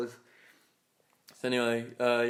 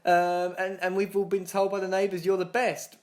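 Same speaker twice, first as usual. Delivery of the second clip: higher-pitched pitchman voice; doorstep sales patter; 6 semitones higher than usual. Retold or imitated. Retelling his pitch to strangers on doorsteps, sales patter. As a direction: put on a chirpy sales pitch.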